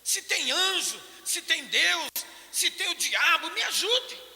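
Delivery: high, loud, pleading cry for help; a frantic prayer, voice pitched high and straining; desperate plea. Retold or imitated. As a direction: beg anyone at all to help you. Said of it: "E comecei a pedir".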